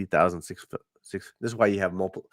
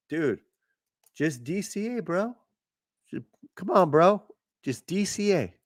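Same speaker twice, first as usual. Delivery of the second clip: high mocking bro voice; lightly voiced crypto bro; 8 semitones higher than usual.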